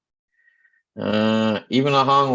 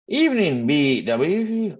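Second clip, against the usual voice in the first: cheery greeting; 7 semitones higher, much slower than usual. Real, not acted. Greeting a viewer.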